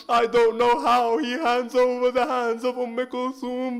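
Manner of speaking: lamenting majlis recitation. Acted. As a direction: pour grief into every line as you recite the masaib. Majlis masaib recitation rising to lament.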